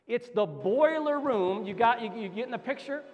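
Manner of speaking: high, loud, rallying exhortation; impassioned preacher's crescendo; full-force preaching. Real, not acted. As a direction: drive each phrase home like a rally cry.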